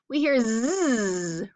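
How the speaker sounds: slow drawn-out buzz on "Z". Shown as a demonstration. Teacher modelling the Z buzz for kids. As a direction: stretch the "Z" buzz. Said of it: "What do we hear at the beginning".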